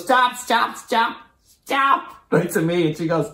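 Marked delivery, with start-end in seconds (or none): 0.0-2.2 high-pitched repeated interruption; retold urgent halting. Host cutting in on the comic onstage, imitated high-pitched halt. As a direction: imitate someone halting the show, pitched right up.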